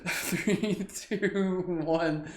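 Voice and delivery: high, lilting count down; countdown. Real, not acted. Teacher ending laughter round, high count.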